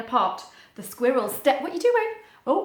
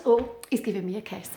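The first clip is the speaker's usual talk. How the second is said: ordinary delivery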